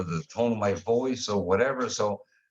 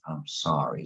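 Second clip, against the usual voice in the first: lower, softer, mournful "I'm sorry"; sad sorry; 8 semitones lower, 3 dB quieter than usual. Shown as a demonstration. Showing how apologies usually sound, mock sad. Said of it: "You gotta say".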